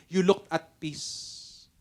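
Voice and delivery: very slow, drawn-out; slow savoring. Retold or imitated. Pastor savoring the attendee's words, very slowly. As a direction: linger on every word. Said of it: "dun sa pangalawang sinabi niya".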